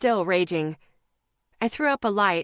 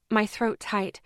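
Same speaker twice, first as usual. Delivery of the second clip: ordinary delivery, nothing marked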